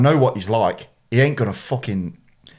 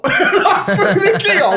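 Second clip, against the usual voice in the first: high-pitched, louder laughing through the words; real laughing; 4 dB louder than usual. Real, not acted. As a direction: crack up while telling it. Cracks up retelling the keyhole moment.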